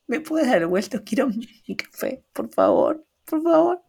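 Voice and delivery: high begging please, please; comic pleading. Retold or imitated. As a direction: beg him for the coffee. Pleading for change and coffee.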